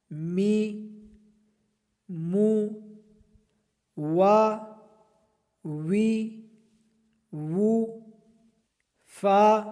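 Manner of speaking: each syllable formed clearly with the lips; lip letters. Shown as a demonstration. Teacher drills lip letters, clearly shaped with the lips. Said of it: "ہونٹوں سے ادا کریں گے".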